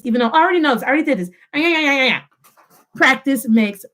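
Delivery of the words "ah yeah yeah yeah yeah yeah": higher, rattled-off mock brush-off; dismissive mimicry. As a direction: imitate a know-it-all waving it away. Mimics students dismissing what they know.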